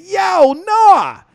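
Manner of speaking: loud, high-pitched call of "Yo, Noah!". Acted. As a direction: yell his name to get his attention. Someone calling out to Noah.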